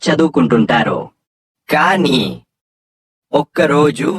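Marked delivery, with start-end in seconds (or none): none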